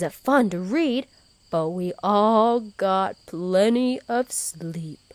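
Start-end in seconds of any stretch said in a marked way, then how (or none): none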